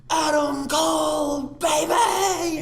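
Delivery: high slow shrieked catchphrase; kid screaming. Retold or imitated. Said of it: "screams and goes like".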